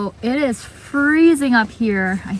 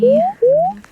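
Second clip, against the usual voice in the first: playful high mock voice; 7 dB louder than usual. Teasing a cougar on the trail.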